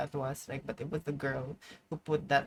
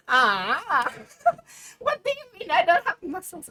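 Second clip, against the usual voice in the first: much higher, louder laughing protest; laughing mock hurt; 13 semitones higher, 7 dB louder than usual. Real, not acted. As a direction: squeal through laughter as if insulted. Mock offence at a comment.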